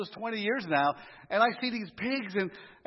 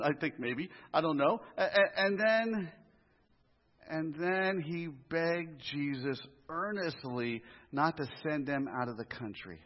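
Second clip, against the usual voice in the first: ordinary talk, nothing marked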